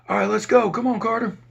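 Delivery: high, loud, rushed officer voice; a guard in a hurry, calling out loud; hurried officer. Retold or imitated.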